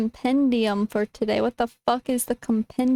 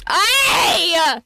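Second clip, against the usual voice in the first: loud shout; 3 semitones higher, 5 dB louder than usual. Real, not acted. Streamer yelling.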